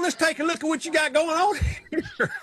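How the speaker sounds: high, laughing Southern surgeon voice; thick Southern drawl. Retold or imitated. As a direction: lay on a Southern accent like a folksy surgeon. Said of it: "he understands about the Southern accent".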